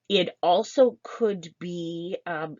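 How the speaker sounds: ordinary delivery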